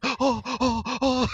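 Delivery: high, loud frightened yelps; mock panic. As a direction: yelp while running off. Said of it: "as he's like".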